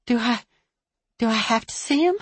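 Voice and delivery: ordinary delivery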